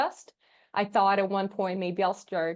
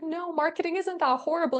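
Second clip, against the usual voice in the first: higher-pitched mock protest; retold protest; 8 semitones higher than usual. Daughter reassures her mom on the phone, higher-pitched mild protest.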